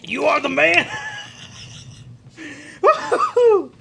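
high whooping cheer with laughter; excited whoop. Real, not acted. Celebrating after eating hot pepper.

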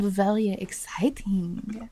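whispered slowly, seductive; breathy tease whisper. Acted. Host teasing a sexy Paris episode, slow whisper. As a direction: whisper it like a secret.

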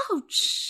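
sharp loud ouch; yelp of pain. Acted. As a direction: cry out as you're struck. Prince hits a branch.

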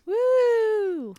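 high, loud whoop; a happy little woo; excited cheer. Real, not acted. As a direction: cheer the topic on. Cheering the start of Critter Week.

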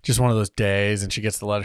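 ordinary delivery

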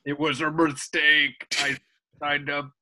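low, mournful demon confession; sad demon voice. Acted. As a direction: moan it like a defeated demon.

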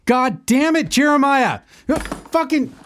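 furious yell. Acted. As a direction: snap completely at him. Host loses temper at friend.

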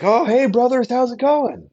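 high, loud friendly hello to strangers; hammed-up cheery greeting. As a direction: call out to them like old pals. [name] greeting passing missionaries.

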